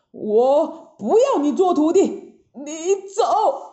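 indignant monk's rebuke, heavily weighted words; acted righteous anger; stern banishment. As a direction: scold and disown your disciple. Master angrily banishes disciple.

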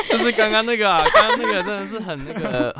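laughing off the embarrassment; embarrassed laughter. Real, not acted. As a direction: giggle through the words. Laughing, embarrassed about crying story. (尴尬地笑着说)

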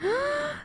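a high, sharp intake of breath; high gasp. Real, not acted. Co-host gasps hearing of the Dior dress. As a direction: gasp in awe at the gown.

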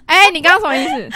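mock offended. Mock offended at friend's joke.

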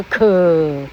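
可 said as a long, trailing "kuh"; voiced k syllable. Shown as a demonstration. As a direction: drag out the vowel in 可. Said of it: "不要念出来". (“可”字拖长音)

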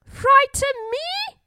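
high, indignant bird scoff. A narrator's reading. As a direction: scoff it way up in pitch. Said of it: "scoffed the bully bird".

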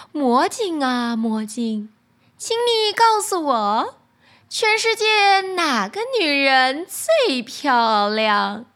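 slow, commanding queen asking; a vain queen summoning her mirror, slowly. Acted. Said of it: "问道".

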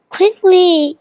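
high, urgent call; mild yell. A narrator's reading. Hassan warns the children of the bird, mildly yelling. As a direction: raise the pitch to hurry them. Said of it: "yelled Hassan".

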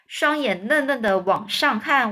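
ordinary delivery, nothing marked

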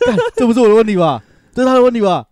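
loud, high, fast flustered protest; mocking his defensive denial. Friend mimicking him protesting to the boss, loud fast denial. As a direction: imitate him denying it in a rush. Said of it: "他的反应，反正就是".

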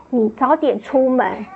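ordinary talk

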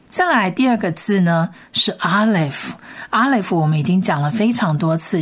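usual speaking voice

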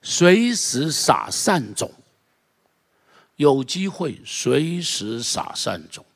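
very slow, drawn-out stress on each word; slow emphatic. Real, not acted. Preacher driving home his point to the church, very slow. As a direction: spell out 随时撒善种 slowly, word by word. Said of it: "这个就是随时撒善种".

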